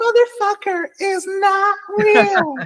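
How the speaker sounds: high squeal, "real" falling off; squealing disbelief. Real, not acted. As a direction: shriek it in comic disbelief. Mock outrage at cartoon agent.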